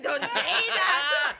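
laughing imitation. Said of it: "She was like".

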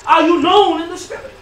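high, loud challenge; full-force preaching. Real, not acted. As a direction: throw the question out at the congregation. Challenges the congregation.

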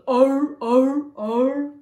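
loud repeated walrus barks; walrus bellow. Shown as a demonstration. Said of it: "Walrus goes".

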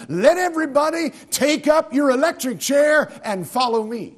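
playful preacher voicing Jesus; mock Jesus voice. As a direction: ham up the absurd command. Ironic quote of hypothetical Jesus.

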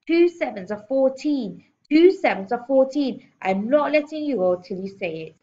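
loud, high, drilled-in headmistress roar; bellowing. A narrator's reading. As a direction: hammer the answer into a pupil at full voice. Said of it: "and Miss Trunchbull bellowed".

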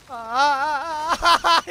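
slow, rising dismayed wail; dismayed groan. Real, not acted. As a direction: groan as the house burns. Watching house burn. (嘆きの声)

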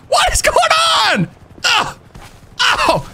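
high, shocked cry; surprised shout. Real, not acted. Cop startled by the dog, shocked shout. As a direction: blurt it out in disbelief.

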